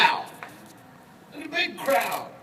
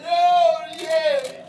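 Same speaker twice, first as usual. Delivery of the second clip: high, much louder whoop; excited shout; 5 semitones higher, 8 dB louder than usual. Acted. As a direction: let out a thrilled yell. Man runs toward Jesus.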